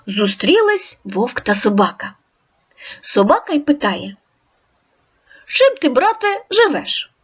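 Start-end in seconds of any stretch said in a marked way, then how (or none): 5.5-7.1 friendly dog, voiced higher; storybook dog greeting the wolf; dog character. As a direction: ask like a curious friendly dog.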